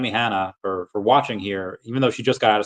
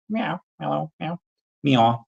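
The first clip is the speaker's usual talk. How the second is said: high cat meows at a chatter; playful meows; 5 semitones higher, slower than usual. Pretend. Greeting a chatter called Mellow with cat meows. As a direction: meow like a silly cat.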